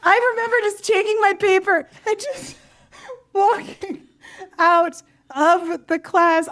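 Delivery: high, slow near-sob with laughs breaking in; mock tearful retelling. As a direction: act out crushed retreat, half laughing. Retells walking out crushed from meeting.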